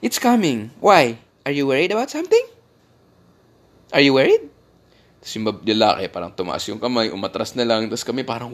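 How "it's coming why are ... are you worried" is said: gate agent's calm challenge. Retold or imitated. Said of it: "sinasabi ng babae sa boarding gate".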